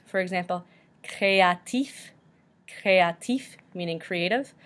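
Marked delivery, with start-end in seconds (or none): 1.0-3.6 créatif drawn out as cray-ah-TEEF; French pronunciation. Shown as a demonstration. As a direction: say créatif slowly, sounding the final F. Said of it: "F is also pronounced at the end".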